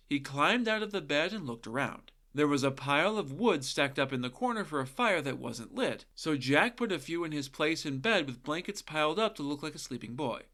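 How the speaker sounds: usual speaking voice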